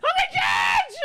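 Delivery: high frantic yells of the name; screaming from afar in a panic; panicked yelling. Retold or imitated. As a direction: yell it like he's lost her. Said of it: "He's like, he hears".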